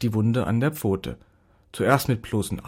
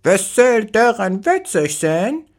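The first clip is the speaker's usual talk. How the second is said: much higher, louder, slow offended squawk; outraged bird taking offence; indignant parrot; 10 semitones higher, 6 dB louder, slower than usual. Acted. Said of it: "bemerkte empört".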